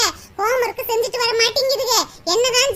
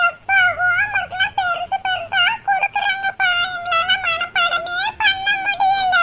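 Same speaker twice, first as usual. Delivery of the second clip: put-on high, slow little kid complaining to dad; child begging father for less homework; whiny child; 6 semitones higher, slower than usual. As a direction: pitch up into a pleading child. Mother voices child complaining to dad.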